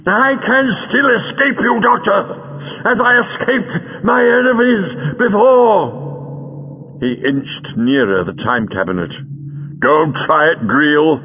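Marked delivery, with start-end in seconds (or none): 0.0-7.0 high, slow, strained villain voice; defiant villain. A narrator's reading. Feeble villain defies the Doctor. 9.8-11.2 raised, emphatic warning; urgent warning. A narrator's reading. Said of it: "warned the Doctor".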